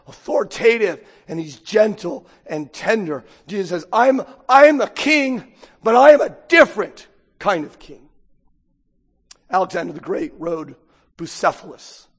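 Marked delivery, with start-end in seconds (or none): 3.9-8.1 proclaiming king. Acted. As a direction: declare it to the whole room. Preacher voicing Jesus's declaration.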